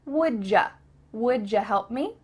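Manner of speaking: "Would ya" blended as "woodjuh"; linked casual question; reduced wouldja. Shown as a demonstration. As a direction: model "Would ya" with a j for repeating. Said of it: "Can you say that with me?".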